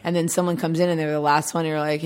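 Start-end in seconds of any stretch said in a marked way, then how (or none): none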